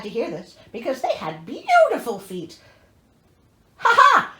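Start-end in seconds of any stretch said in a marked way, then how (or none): 3.8-4.3 loud high triumphant "ha ha"; gleeful laugh. Real, not acted. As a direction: cackle with delight. Gleeful laugh at gore coming.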